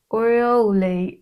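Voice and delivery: slow, drawn-out farmer-like Irish lilt; half-farmer, half-Irish accent. Real, not acted. Tarot reader stumbling over a card word, half-Irish farmer accent. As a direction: stretch it out in an accidental rural Irish accent. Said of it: "half Irish accent".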